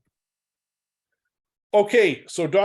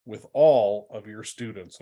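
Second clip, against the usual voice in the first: "all" punched louder and set apart; stress on "all"; 4 semitones lower, 3 dB louder than usual. Shown as a demonstration. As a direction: lean firmly on the word "all". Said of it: "I like the emphasis on the word all".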